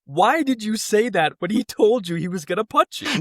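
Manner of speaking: high-pitched, hammed-up disbelief; mock incredulous scolding. Scoutmaster to the punched kid, mock incredulous. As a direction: imitate a baffled telling-off, voice climbing.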